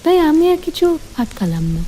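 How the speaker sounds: plain reading voice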